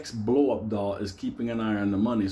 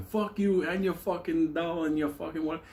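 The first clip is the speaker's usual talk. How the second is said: higher, mock-angry outburst; pissed-off rant; 7 semitones higher than usual. Angry cow cursing out his business partner, pissed off. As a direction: imitate an angry man mid-argument. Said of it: "the cow gets fucking pissed off".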